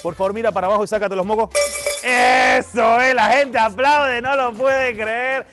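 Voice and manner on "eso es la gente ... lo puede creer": loud, high, stretched ringmaster intro; showman announcement. Acted. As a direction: present the star like a circus act. Magician calls for applause.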